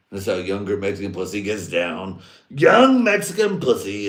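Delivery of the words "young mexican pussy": big high yelled punchline; mock shout. Warning the crowd about a trap, yelled high. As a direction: belt it out high and loud.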